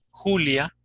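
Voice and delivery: "Julia" with a throaty H sound for J; J of "Julia" pronounced like a rough H; Spanish J. Shown as a demonstration. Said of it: "¿Cómo sería en español?".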